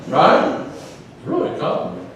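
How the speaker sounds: usual speaking voice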